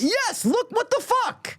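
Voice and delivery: high, slow exasperated whine; Jack Nicky voice. Acted. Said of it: "'Cause you were doing the Jack Nicky".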